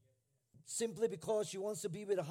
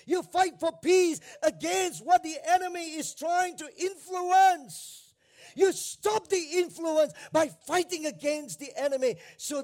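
higher, loud, driving sermon voice; fiery preacher call to fight; full-force preaching; 8 semitones higher, 8 dB louder than usual. Acted. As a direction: preach it out hard to the congregation.

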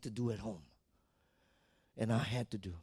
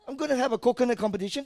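usual speaking voice

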